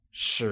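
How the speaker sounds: Shi rising from mid pitch to high. Shown as a demonstration. Teacher modelling Shi with a mid-rising tone for learners. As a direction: lift Shi upward like a question. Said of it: "pronounced with a mid-rising tone".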